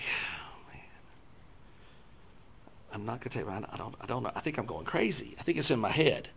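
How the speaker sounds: soft whispered self-doubt. Retold or imitated. Man in prayer line doubting himself, whispered. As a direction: mutter it to yourself, unsure.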